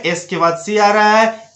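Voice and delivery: normal talking voice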